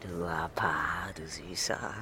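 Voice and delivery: slow, low, teasing whisper; tender whisper. Acted. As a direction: intimate whispered pet names. Teasing lover's endearment.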